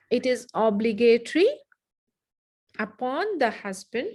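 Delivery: slow dictation for note-taking; slow repeat. Shown as a demonstration. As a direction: dictate in chunks with long pauses.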